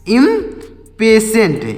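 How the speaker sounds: "इम्पेशेंट" in two slow parts, im, then peshent; prononciation modèle. Shown as a demonstration. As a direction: break "इम्पेशेंट" after im, drawn out for learners. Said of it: "क्या पढ़ेंगे?".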